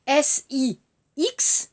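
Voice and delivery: name each letter of "S I X" slowly and clearly; spelling out letters. Shown as a demonstration. Teacher spelling the word for students, letter by letter. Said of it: "Alors, six".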